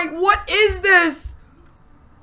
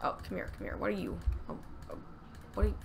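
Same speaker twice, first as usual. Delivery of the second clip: whispered coaxing; soft whispered come here; 8 semitones lower, 16 dB quieter, much faster than usual. Real, not acted. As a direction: breathe it out under your breath. Whispering to a creature.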